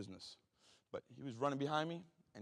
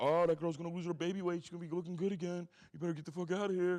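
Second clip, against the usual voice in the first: raised, louder imitation of a heckler; mock jeering; 6 semitones higher, 5 dB louder than usual. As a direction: jeer it like a taunting inmate. Imagined inmate jeering at new dad.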